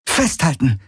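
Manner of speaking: high urgent call. A narrator's reading. Kirai warning Garland as the animals charge, high urgent call. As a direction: yell the warning before the mounts bolt. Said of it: "rief sie".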